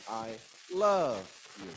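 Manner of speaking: slow, with heavy emphasis on the middle word, "love"; stress on LOVE. Shown as a demonstration. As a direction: lean long and hard on "love". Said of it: "I'm using emphasis".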